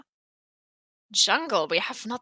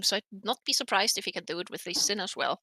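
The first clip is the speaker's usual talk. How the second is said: ordinary delivery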